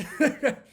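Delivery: sudden loud burst of laughter; high, loud laugh. Real, not acted. Laughs at own joke.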